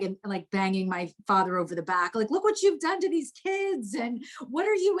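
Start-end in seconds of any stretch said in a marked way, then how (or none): 2.3-5.0 put-on high-pitched scolding, retelling an old aunt; aunt yelling. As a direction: shrilly scold the father with a retold tirade. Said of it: "like, yelling at him".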